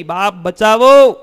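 loud, high, drawn-out wail for rescue; cry for help. Acted. Beaten women begging to be saved, acted drawn-out shout.

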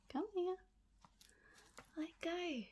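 high soft coaxing coo. Real, not acted. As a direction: sweet-talk it to let go. Coaxing snail off lid.

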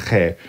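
che said as a raspy Spanish j, "khe"; unwanted throaty sound. Shown as a demonstration. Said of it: "we don't want that".

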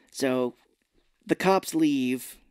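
ordinary delivery

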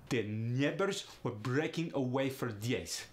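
Russian accent with glided "ay" vowels. Shown as a demonstration. [name] demonstrates the A in great, Russian accent. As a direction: say "neighbors" as "nyeh-bors", Russian accent. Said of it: "In Russian, becomes aya".

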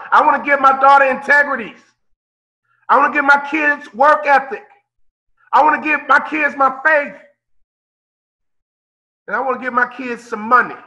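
rising emphatic sermon cadence; preaching. Acted. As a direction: hammer each gift home. Preacher building emphatic list.

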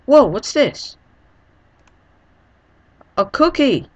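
high, loud sudden delight; excited. Real, not acted. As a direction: burst out with real excitement. Excited discovery.

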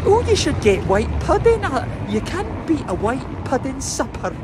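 high, bright imitation of Carrie's mum; mimicking a Scottish mum recommending food; imitating Carrie's mum. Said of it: "she said".

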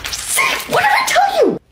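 triumphant yell; gloating shout. Acted. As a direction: crow it loudly. Gloating to friend.

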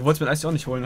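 usual speaking voice

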